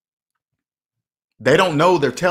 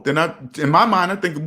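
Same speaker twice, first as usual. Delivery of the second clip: ordinary talk, nothing marked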